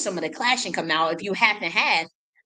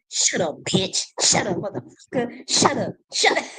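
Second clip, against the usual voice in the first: imitated rage climbing with each shut up; mock angry yelling; 4 semitones higher than usual. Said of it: "started talking like that".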